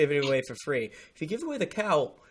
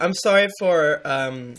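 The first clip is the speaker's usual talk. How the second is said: ordinary talk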